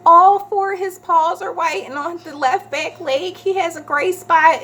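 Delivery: high, anxious rush of details; frantic description. Retold or imitated. Reporting the lost cat to the rental office, high and anxious. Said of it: "I was like".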